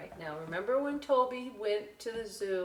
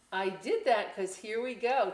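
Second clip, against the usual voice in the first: ordinary delivery, nothing marked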